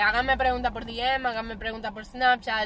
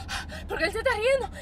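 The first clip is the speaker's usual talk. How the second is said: retold panic; 11 semitones higher than usual. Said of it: "y yo dije".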